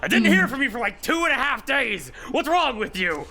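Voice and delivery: high loud scolding mid-hug; relieved scolding. Acted. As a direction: scold through a crushing hug. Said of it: "and she goes".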